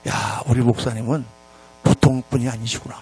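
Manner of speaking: low-pitched musing, quoting someone's admiration; awed inner thought, imitated. Deacon marvelling at his pastor, low imitated awe. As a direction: murmur it to yourself in mock awe. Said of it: "그러면서 이렇게 생각했대".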